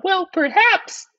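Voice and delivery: very high falsetto; high-pitched, tentative wife; suggesting wife. Acted. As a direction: suggest it in a piping wife's voice.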